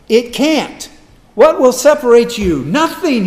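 loud, high, insistent assurance; full-force preaching. Acted. Preacher telling the congregation nothing will separate them, full-force preaching. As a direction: hammer the promise home to the congregation.

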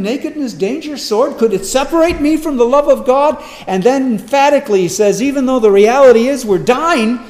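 loud, high-pitched, urgent sermon delivery; impassioned preaching. Acted. Preacher challenging the congregation on suffering and Christ's love, impassioned preaching. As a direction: drive each hardship home with force.

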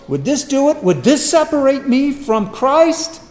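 loud, high-pitched, urgent sermon delivery; impassioned preaching. Acted. Preacher challenging the congregation on suffering and Christ's love, impassioned preaching. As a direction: drive each hardship home with force.

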